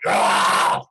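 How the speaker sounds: loud, rough mock yell; mock roar. Describing how a tough surfer rides, mock roar. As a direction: let out an aggressive roar. Imitating a tough surfing style.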